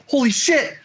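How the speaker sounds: high, amazed squeal of disbelief; mock excited friend. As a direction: gush like a stunned fan. Voicing William's excitement.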